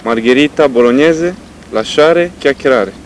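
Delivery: put-on loud Italian-accent reading; Italian intonation. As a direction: read it like an Italian, with rises. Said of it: "și tare și cu intonație".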